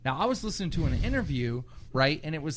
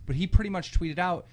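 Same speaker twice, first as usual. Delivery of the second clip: usual speaking voice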